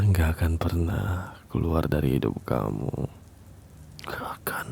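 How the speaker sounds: whispered possessive promise; a chilling whisper that dies to nothing; menacing whisper. Acted. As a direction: whisper it close, fading away.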